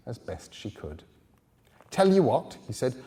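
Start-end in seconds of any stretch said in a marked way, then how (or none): 1.9-2.6 lightly voiced helpful stranger, higher and louder; a hint of an eager helper, raised voice; helpful man. A narrator's reading. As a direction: pitch up a friendly offer.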